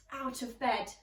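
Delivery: plain reading voice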